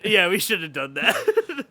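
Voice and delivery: laughing mockery of the producer's confession; mocking Jason Blum. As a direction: parrot the producer's regret through a laugh. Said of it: "Jason Blum has gone on record to say".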